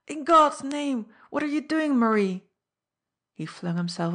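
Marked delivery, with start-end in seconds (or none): 0.1-2.5 high, loud, frantic demand; wild cry. A narrator's reading. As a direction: cry out in desperate disbelief. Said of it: "he cried out wildly".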